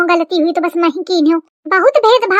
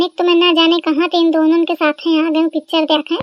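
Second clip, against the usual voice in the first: usual speaking voice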